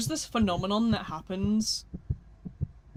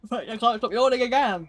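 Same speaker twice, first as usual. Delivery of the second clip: loud, fed-up complaint; exasperated; 3 semitones higher, 7 dB louder than usual. Real, not acted. Streamer listing crash outs to chat, exasperated. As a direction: burst out with it in exasperation.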